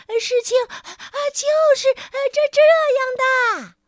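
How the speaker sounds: high squeaky halting sprite; breathless sprite. A narrator's reading. Sprite explains while flying. (气喘吁吁的小精灵)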